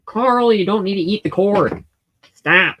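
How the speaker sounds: loud, sharp rebuke to a pet; scolding the cat. Real, not acted. Yelling at the cat for chewing the cord, loud and sharp.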